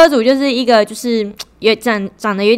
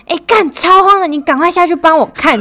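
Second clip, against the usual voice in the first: panicky, higher and louder; retold panic; 9 semitones higher, 5 dB louder than usual. Driver who scraped a car begs friend to check, in mock panic. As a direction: blurt it out like you just scraped a car. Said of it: "然后我就很慌张".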